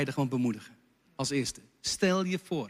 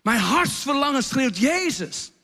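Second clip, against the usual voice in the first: higher, slow and yearning, the Ah lifted; crying out refrain; 6 semitones higher, slower than usual. Shown as a demonstration. Leader showing the chorus as a cry of the heart, yearning. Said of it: "wat knijpen we dan uit in het refrein".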